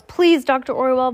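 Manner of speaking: high, loud plea; cried plea. A narrator's reading. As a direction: cry out, begging for mercy. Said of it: "Violet cried".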